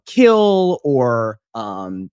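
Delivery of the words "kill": loud, high one-word order; punchy mock battle cry; imitated shouted command. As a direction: belt it out like a superpower command. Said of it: "he can say, like, you know".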